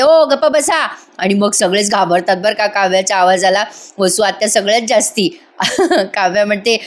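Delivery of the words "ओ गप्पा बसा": high, louder mock shout to silence. Kavya silencing the squabbling family, loud shout. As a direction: yell to shut everyone up. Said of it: "अजून मोठा आवाज काढते".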